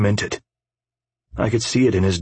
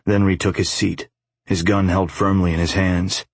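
plain reading voice

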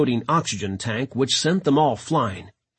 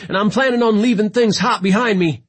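raised, high-pitched call; calling back; 8 semitones higher, 5 dB louder than usual. A narrator's reading. London escaping the nest to his boss, calling out. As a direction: project it to someone far off.

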